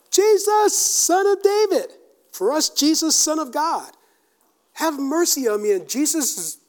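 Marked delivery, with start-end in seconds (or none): 0.1-1.9 high, loud, drawn-out call; yelling. Acted. Blind beggar stepping into it, yelling to Jesus. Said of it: "he's yelling". 2.7-5.7 high, loud appeal for mercy; raised pleading call. Acted. Preacher voicing everyone's plea to Jesus, raised call. Repeats the beggar's cry for mercy.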